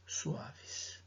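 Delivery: breathy whispered ending. Acted. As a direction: let the last word fade to a whisper. Poem line fading to whisper.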